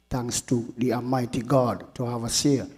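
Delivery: usual speaking voice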